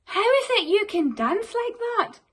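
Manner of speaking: high, loud pleading animals; eager animals. Acted. Animals begging Gerald to share his secret, high eager. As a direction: beg him eagerly. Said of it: "animals begging Gerald".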